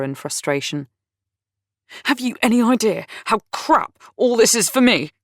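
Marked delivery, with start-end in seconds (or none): 2.1-5.1 raised, higher voice bursting out in anger; angry outburst. A narrator's reading. Dudley bursting out at Lizzie, angry and raised. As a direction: let it burst out of him, fed up. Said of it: "he burst out".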